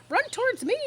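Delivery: high, loud excited goose; goose crying out. A narrator's reading. Goose shouting orders to Wilbur, high and excited. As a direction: urge the pig on in a high honking cry. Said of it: "yelled the goose".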